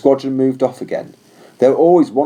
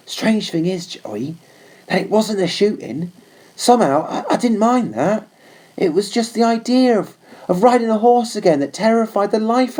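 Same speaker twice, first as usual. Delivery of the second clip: put-on higher, earnest young soldier's voice; young trooper confiding; 6 semitones higher than usual. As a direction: speak it gently to the horse as a friend. Warren confides in his horse.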